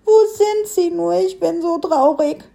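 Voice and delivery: high, tearful little voice; weeping piglet. A narrator's reading. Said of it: "weinte das kleine Kerlchen".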